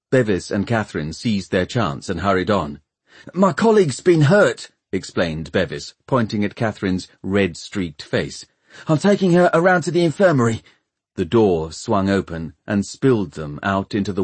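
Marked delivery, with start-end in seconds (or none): 3.3-4.7 high, hurried explanation; urgent young man. Acted. Bevis bluffing the guards. 8.9-10.6 high and fast excuse; hurried. Acted. Bevis bluffing, hurrying.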